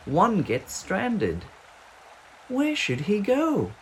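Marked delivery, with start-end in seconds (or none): none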